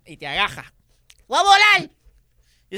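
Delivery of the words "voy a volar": much higher, louder hammed-up cheer; high, loud silly pilot about to fly; mock excited cry. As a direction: play a silly proud pilot. Mocking the pilot in the seat.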